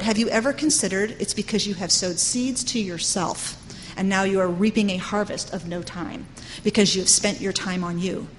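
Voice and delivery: usual speaking voice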